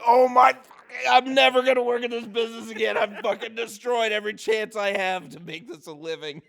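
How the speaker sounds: high, loud, fast panicked outburst; retold panic. Man retelling wrecking the light on set, high, loud, panicked outburst. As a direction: blurt it in a rush of mock despair.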